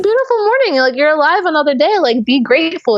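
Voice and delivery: high, bright sing-song cheer; chirpy morning cheer. Retold or imitated. Telling a grumpy coworker to be grateful, high sunny cheer. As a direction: beam it out like pure sunshine. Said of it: "I'd be like".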